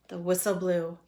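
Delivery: ordinary delivery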